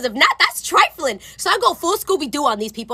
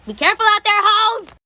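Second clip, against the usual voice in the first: higher, projected send-off; called-out warning; 6 semitones higher than usual. Acted. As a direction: call it out to everyone.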